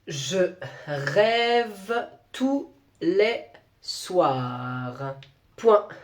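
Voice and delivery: very slow teacher dictation, dropping low on soirs; slow dictation read to pupils. Acted. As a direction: stretch each word out as a teacher dictating. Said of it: "fais ta fausse dictée".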